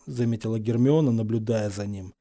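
ordinary delivery, nothing marked

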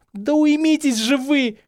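high, drawn-out exasperated shout; desperate cry. A narrator's reading. Hermione to both quarrellers, shouting in despair. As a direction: cry out at the end of your tether. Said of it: "крикнула в отчаянии Гермиона".

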